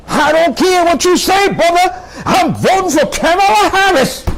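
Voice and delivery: hammed-up defiant voter, sky-high voice; imitating a stubborn voter.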